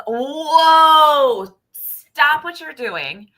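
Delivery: loud, high whoa, then slow stop; the body slamming on the brakes; body's alarm. Retold or imitated. The body calls out to stop the patient, loud and slow. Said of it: "Your body says".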